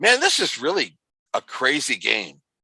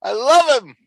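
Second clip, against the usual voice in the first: delighted shout; 9 semitones higher, 4 dB louder than usual. Real, not acted. Delighted by the kid.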